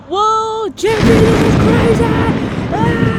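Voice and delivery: loud, thrilled shout; excited yell. Acted. Kid shouting to Jeffy mid-game, thrilled yell. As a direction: yell it like the ride of your life.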